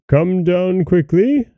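high, drawn-out command, not shouted; slow, restrained cry of an impatient husband. A narrator's reading. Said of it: "cried Bluebeard".

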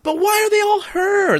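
high, baffled disbelief; incredulous. Real, not acted. Exasperated about identical mermaids.

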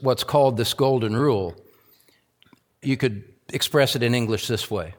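ordinary talk, nothing marked

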